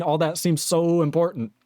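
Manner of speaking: usual speaking voice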